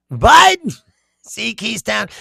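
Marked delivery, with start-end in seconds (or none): 0.1-0.8 high, loud mocking echo of the name; mock answer. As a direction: throw the name back sarcastically.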